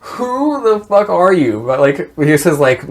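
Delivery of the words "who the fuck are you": high, slow, baffled question; startled. Retold or imitated. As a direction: stretch it out in disbelief. Frodo startled by stranger.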